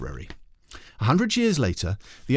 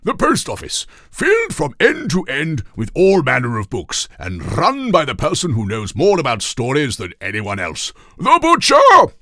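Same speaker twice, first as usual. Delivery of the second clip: high, loud pompous king proclaiming; king presenting the library as the post office; King Toothbrush Weasel voice; 6 semitones higher, 9 dB louder than usual. A narrator's reading. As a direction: announce it grandly with a big finish. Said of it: "this is King Toothbrush Weasel saying this".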